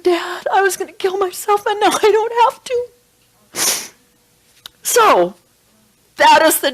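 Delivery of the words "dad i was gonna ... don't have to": put-on much higher, choked-up boy's voice; high, trembling teen blurting the truth; tearful confession. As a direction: voice breaking as the son admits it. Suicidal son confesses to father.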